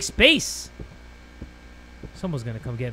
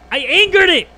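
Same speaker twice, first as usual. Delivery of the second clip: higher, louder panicked cry; panicked yell; 8 semitones higher, 7 dB louder than usual. Real, not acted. Streamer realising he provoked the monster, panicked yell. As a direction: yelp it out in alarm.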